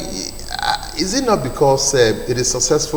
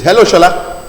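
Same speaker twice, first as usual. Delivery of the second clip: loud, raised voice down a bad phone line; to someone hard of hearing; 6 semitones higher, 9 dB louder than usual. Real, not acted. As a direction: call out so the caller can hear.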